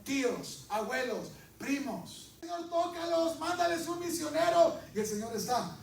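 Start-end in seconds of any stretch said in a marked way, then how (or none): 2.4-4.8 high, loud pleading prayer; mock fervent prayer. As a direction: beg the Lord, overdone. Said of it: "imagined prayer".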